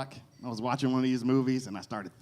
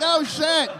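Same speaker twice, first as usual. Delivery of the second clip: scared gasp; 8 dB louder than usual. Retold or imitated. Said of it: "And I was like".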